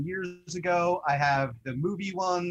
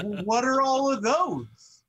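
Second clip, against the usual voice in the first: high, slow, pointed mock question; mock girlfriend; 9 semitones higher, 4 dB louder than usual. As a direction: play the girlfriend catching him out. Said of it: "It's like".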